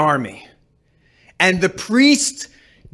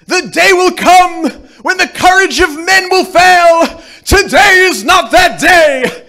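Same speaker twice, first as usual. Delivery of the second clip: high, loud heroic battle cry; rally the men before battle; Aragorn speech; 9 semitones higher, 5 dB louder than usual. Retold or imitated.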